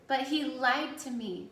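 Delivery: weeping protest. A narrator's reading. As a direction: protest through mildly acted tears. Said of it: "Jonas wept".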